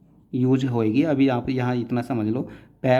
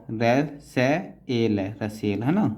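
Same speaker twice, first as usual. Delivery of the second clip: usual speaking voice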